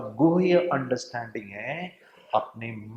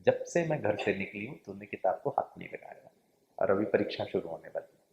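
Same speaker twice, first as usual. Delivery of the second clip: soft, gentle motherly coaxing, much quieter; a mother softly coaxing her child to study; mother gently urging son to switch off TV, soft and loving; lovingly; 5 semitones lower, 8 dB quieter than usual. Retold or imitated. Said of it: "बहुत प्यार से उसको कहा".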